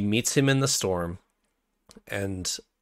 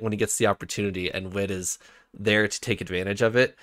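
normal talking voice

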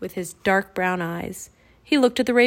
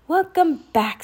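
high, loud greeting; happy welcome; 7 semitones higher, 6 dB louder, slower than usual. A narrator's reading. Jack greeting Teddy as a boy again, happy welcome. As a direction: welcome a friend back gladly.

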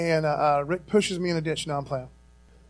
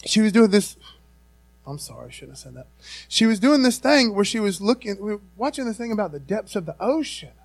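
ordinary talk

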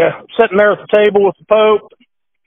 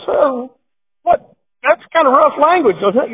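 put-on higher, mildly startled old cardinal; shocked cardinal; 8 semitones higher than usual. Cardinal hears the rough language, mildly shocked. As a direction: act a little scandalized. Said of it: "He says".

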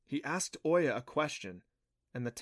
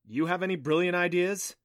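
louder, higher, challenging question; frustrated demand; 6 semitones higher, 6 dB louder than usual. A narrator's reading. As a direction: throw the question out in mild exasperation. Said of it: "he demanded in frustration".

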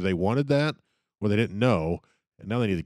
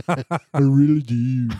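slow, high, loud Trump mimic; Trump impression; 6 semitones higher, 9 dB louder, much slower than usual. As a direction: drawl it out like Donald Trump. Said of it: "He don't sound anything like Don".

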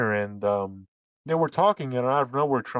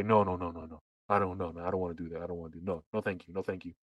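quietly declining; 8 semitones lower, 8 dB quieter, faster than usual. Retold or imitated. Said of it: "And Xi Jinping would say".

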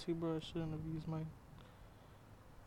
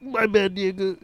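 mock apology; 4 semitones higher, 5 dB louder, much slower than usual. Real, not acted. Mock apology after win.

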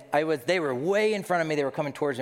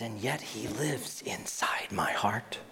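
whisper; 4 semitones lower, 6 dB quieter, slower than usual. Acted.

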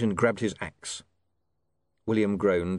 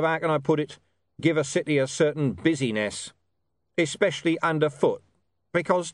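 higher-pitched watch commander, mock-casual and needling; sardonic Vimes; 6 semitones higher than usual. A narrator's reading. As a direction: pile up the bad news with mock afterthoughts.

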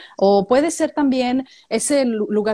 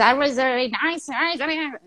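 slow, mumbled Australian English, lips nearly closed; mock Australian drawl; 4 semitones higher than usual. Host imitating an Australian speaking with a shut mouth, slow mumbled Aussie accent. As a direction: drawl it like a country Aussie keeping flies out. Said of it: "Y dices".

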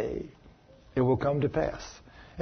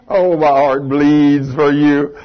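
sarcastic sympathy; 4 dB louder, much slower than usual. Real, not acted. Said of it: "sarcastic reply".

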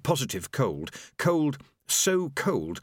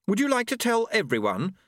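high, teacherly sarcastic question; classroom sarcasm; 11 semitones higher than usual. Acted. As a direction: challenge them like a sarcastic schoolteacher. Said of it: "reverting to classroom sarcasm".

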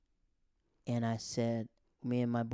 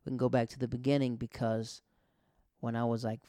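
ordinary talk

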